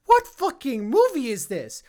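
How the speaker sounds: stunned outburst at a bizarre film; incredulous reaction. Retold or imitated. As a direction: blurt it out, utterly baffled.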